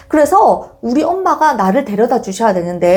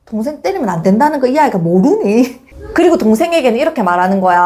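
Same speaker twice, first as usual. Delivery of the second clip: ordinary talk, nothing marked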